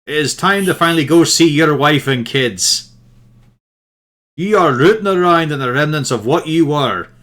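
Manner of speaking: loud, forceful telling-off; commanding shout. Acted. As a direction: lay down the law to a stubborn ghost. Shouting back at the specter.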